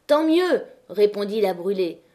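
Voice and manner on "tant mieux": high, loud defiant shout; furious retort. A narrator's reading. La Brûlée answering La Maheude amid the fire, furious retort. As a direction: throw it back in defiance.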